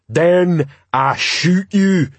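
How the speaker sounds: very slow, high troll voice; troll threat. Acted. Troll answering the crowd, slow threat. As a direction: spell out the threat ponderously.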